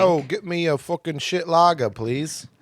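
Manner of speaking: pub order in mock British; bad British accent. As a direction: order like a badly faked Brit. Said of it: "What's, like, a British drink?".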